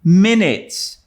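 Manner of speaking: put-on slow, firm warning to a child; strict guardian, slow and emphatic; stern Marilla. A narrator's reading. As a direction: lay down the rule deliberately. Marilla giving strict permission.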